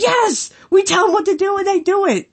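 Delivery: high, gleeful put-on voice; mock gloating. Telling about a wrestler, mimics his gleeful boast. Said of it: "He's like".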